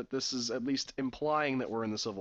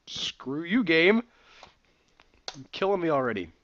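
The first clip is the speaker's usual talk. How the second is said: mock annoyed; 3 semitones higher, 7 dB louder than usual. Real, not acted. Player annoyed at game.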